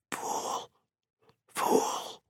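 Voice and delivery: whispered, fading voice; faint sizzle. A narrator's reading. Faber's muffled earpiece scolds Montag, faint whisper. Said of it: "It sizzled faintly".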